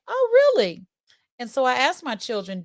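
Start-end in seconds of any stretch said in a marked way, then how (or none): 0.1-0.8 sarcastic surprise. Retold or imitated. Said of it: "And I was like".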